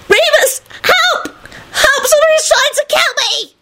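very high, shrieking cry for help; panicked yell. A narrator's reading. Rosie yelling into her ring, shrieking in terror. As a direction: shriek frantically for rescue. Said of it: "yelled into it".

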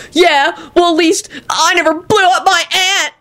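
high shrieking stoner retort. A narrator's reading. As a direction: shriek it back childishly. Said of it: "shrieked Kenny".

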